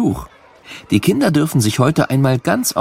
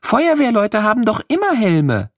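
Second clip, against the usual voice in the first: higher, lightly voiced small child's question; little girl asking; 12 semitones higher than usual. Little girl asking the firemen about helmets, curious child voice. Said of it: "fragt Lilli".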